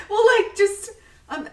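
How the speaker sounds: laughing. Real, not acted. Laughing after shocking confession.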